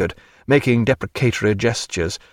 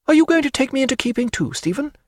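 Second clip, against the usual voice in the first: with a smile. A narrator's reading. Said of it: "she asked, with a smile".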